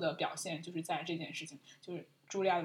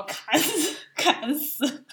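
laughing; 8 semitones higher, 7 dB louder, much slower than usual. Real, not acted. Laughing at her own joke.

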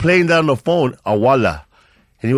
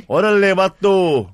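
higher, louder Chicano Orale vato; Chicano greeting; 7 semitones higher, 4 dB louder, slower than usual. Shown as a demonstration. Greeting a friend East LA style, Chicano accent. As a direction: throw out Orale vato like a homeboy. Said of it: "S0 asks East L A".

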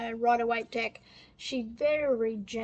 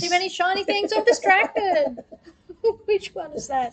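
laughing; 7 semitones higher, 6 dB louder than usual. Real, not acted. Giddy over too many cards.

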